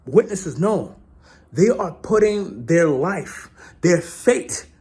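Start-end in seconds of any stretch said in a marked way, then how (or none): none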